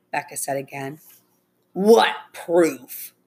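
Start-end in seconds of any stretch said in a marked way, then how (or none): 1.7-3.2 scornful challenge in a character voice; sarcastic. A narrator's reading. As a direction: challenge them with mild sarcasm. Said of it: "the pickup driver asked sarcastically".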